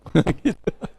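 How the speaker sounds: quick bright burst of laughter; short laugh. Real, not acted. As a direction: laugh at the story. Laughs at own story. (tawa singkat)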